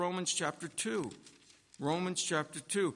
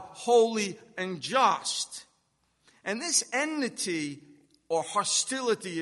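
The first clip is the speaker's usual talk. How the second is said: loud, higher-pitched pulpit delivery; forceful preaching; 4 semitones higher, 6 dB louder than usual. Real, not acted. Preacher on the sinner's enmity with God, forceful preaching. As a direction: drive each phrase home with force.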